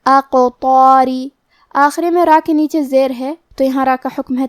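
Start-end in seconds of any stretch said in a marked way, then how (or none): 0.1-1.3 slow recited "اقطاری" with heavy ط and long heavy alif; heavy-voiced letters. Shown as a demonstration. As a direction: recite "اقطاری" slowly, rounding out the "طا" full and heavy. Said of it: "مد الف کو بھی بھاری آواز میں پڑھیں گے".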